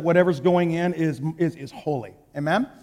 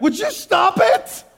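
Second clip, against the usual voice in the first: much higher, slow, drawn-out fed-up cry; exasperated outburst; 12 semitones higher, slower than usual. Retold or imitated. Preacher yelling at his ringing phone, higher and slow. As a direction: fling it out, fed up, stretching each word. Throws phone across room.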